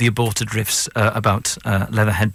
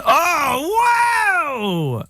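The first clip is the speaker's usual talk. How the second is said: mock gasp. Guest acting out his shocked reaction to the proposal. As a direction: squeal it like you're overwhelmed.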